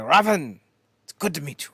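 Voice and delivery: a light mock Italian accent. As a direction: imitate Santino's hammed Italian accent, loudly greeting. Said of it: "that terrible fucking Italian accent".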